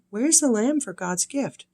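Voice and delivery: put-on high, bright little boy asking; child voice. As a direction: voice young Isaac's innocent question. Child Isaac's question in story.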